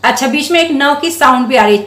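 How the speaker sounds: ordinary talk, nothing marked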